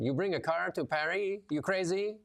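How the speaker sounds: high-pitched mock French hotel clerk; French accent. Hotel clerk scoffing at a tourist, mock French accent. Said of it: "he says in his French accent".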